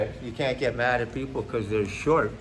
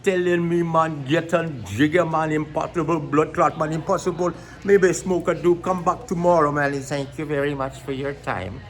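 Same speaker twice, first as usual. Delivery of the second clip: comic Jamaican patois; mock Jamaican accent; 3 semitones higher than usual. Shown as a demonstration. Comedian switches from Swedish mid-bit, mock Jamaican accent. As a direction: put on a hammed-up Jamaican accent. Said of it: "from Swedish to Jamaican back".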